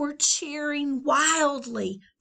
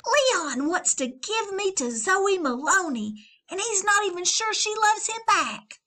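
tattling Valentine; 10 semitones higher, 5 dB louder than usual. Acted. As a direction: sing out the secret.

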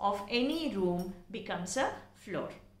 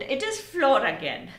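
usual speaking voice

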